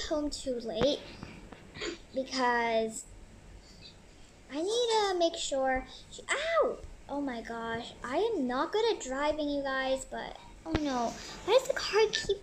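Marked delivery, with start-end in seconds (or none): 6.3-6.8 high startled yelp; sharp yelp. Acted. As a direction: yelp as the car flips. Car flips while driving.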